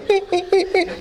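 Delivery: very high, repeated little squeals; mock panicky high yelps; imitated child's frightened squeaks. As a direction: squeak like a scared toddler clinging on. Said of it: "you know, just going".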